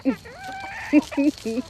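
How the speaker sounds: real high-pitched burst of laughter. Real, not acted. Owner laughing at her pet, high giggle. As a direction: laugh up high at the pet's antics.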